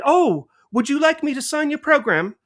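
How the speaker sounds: put-on high, light English accent, sweet and polite; India Fisher impression. Fan imitates India Fisher's English accent, offering to sign. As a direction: impersonate a gracious English actress offering to sign. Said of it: "That's my best India Fisher accent".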